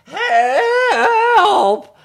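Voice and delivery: high, long, loud wail; yelled cry for help. Acted. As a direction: yell it as the buried judges. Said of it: "yelled the judges".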